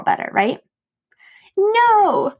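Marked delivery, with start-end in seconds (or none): 1.6-2.3 very high mock-exasperated denial; gleeful high-pitched confession. Real, not acted. Vlogger confessing to listeners she failed again, high and gleeful. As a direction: squeal the admission, about to laugh.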